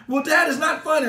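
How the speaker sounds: high, loud hurt son; offended son. Retold or imitated. Son objects to dad laughing.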